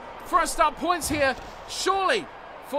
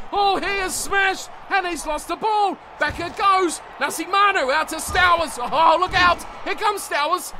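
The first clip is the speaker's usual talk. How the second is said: louder, quicker commentary call; lively play-by-play; 3 semitones higher, 4 dB louder than usual. Acted. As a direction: track the ball with rising urgency. Live call of a big hit.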